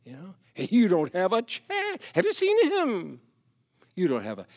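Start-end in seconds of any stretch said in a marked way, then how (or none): 0.0-3.2 mocking, much higher voice of a doubter; scornful doubter. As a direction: sneer it out, pitched up high. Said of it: "you might say".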